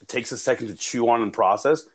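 normal talking voice

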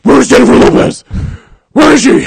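loud, high, raspy Batman shout; hammed-up Batman yell. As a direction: rasp it out as an overblown Batman. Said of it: "my favorite fucking Batman voice ever".

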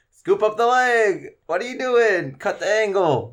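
high, drawn-out coach's yell; yelling at a projector. Retold or imitated. Coaches heckling televised UFC fighters from Wisconsin, yelling. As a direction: holler corner advice at full volume, stretching it out. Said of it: "Yelling at a projector".

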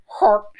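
short high burst mimicking a fart; imitated fart noise. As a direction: blurt out the fart sound. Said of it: "and it went".

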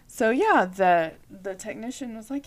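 usual speaking voice

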